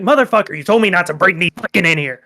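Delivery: snapping at the boss, fast and fed up; retold blow-up at the boss, half reliving it; angry outburst. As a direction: let the swear burst out high and loud, then rattle off the rest.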